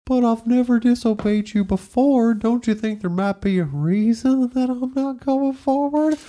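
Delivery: high, slow wounded donkey voice; donkey talks back to its rider, high and slow; talking donkey. Retold or imitated. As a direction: reason gently as a patient donkey. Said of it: "the donkey's like".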